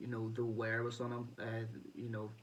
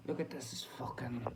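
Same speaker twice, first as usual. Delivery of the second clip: hushed aside; whispered under breath. Real, not acted. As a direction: whisper it to the camera. Hushed aside about bent box.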